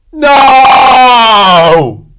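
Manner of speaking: long, loud, high scream; last scream. Acted. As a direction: scream while falling to the tigers. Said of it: "he screamed a last time".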